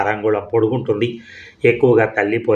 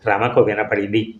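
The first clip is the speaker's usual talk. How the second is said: ordinary delivery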